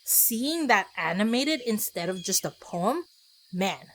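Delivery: normal talking voice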